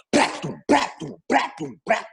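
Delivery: beatbox kick and snare; imitated drum beat. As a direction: spit out the syllables as drum hits. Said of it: "Then he put the drums".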